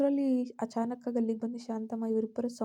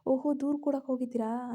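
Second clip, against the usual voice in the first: loud, taunting jeer; mock her for running to complain; mocking sneer; 2 semitones higher, 5 dB louder, faster than usual. A narrator's reading. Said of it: "ಅಣಕದ ನಗು ಬೀರಿದ್ದ". (ಅಣಕಿಸುವ ಗೇಲಿ ದನಿ)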